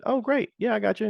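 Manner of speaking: cheery service guy. Retold or imitated. Retells service guy's cheerful reply.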